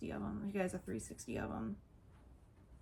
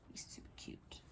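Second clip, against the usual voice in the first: soft breathy-free hush; quiet whisper; 15 dB quieter than usual. Real, not acted. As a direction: whisper it to yourself. Hushed aside.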